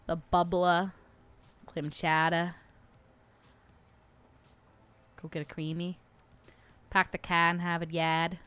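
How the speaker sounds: put-on low-key New England accent, Rs dropped. Streamer trying regional catchphrases, New England accent. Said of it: "New England is my favorite accent".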